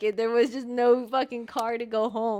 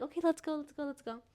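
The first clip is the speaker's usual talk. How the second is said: quick hushed urging, higher and softer; retold "let's go" under the breath; 3 semitones higher, 8 dB quieter than usual. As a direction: act out quietly urging friends along. Sneaking back home at night.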